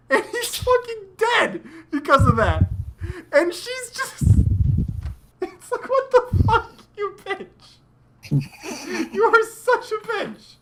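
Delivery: high, indignant outburst; outraged high-pitched rant. Real, not acted. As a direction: squeal it out in disbelief.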